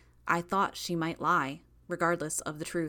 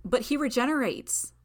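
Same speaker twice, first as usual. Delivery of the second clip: higher, louder protest; 7 semitones higher, 4 dB louder than usual. Acted. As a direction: push back in disbelief. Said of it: "I protested".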